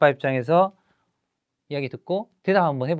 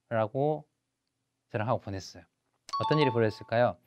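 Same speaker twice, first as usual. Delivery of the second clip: normal talking voice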